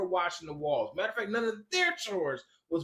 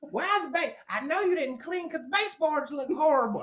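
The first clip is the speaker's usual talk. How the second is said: high mock Southern scolding; put-on high Southern voice; weird Southern accent; 10 semitones higher than usual. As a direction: imitate an exasperated wife in a Southern caricature. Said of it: "giving you a weird Southern accent".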